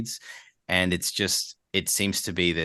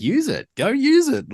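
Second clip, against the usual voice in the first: high, loud burst of encouragement; emphatic urging; 7 dB louder than usual. Retold or imitated. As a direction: urge them on, pitched right up. Urging hesitant talent to use accent.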